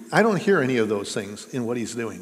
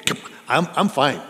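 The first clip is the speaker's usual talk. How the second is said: loud, breezy brush-off, higher; a dismissive friend insisting he's fine; mock cheery denial; 4 semitones higher, 6 dB louder than usual. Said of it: "Somebody will say to you".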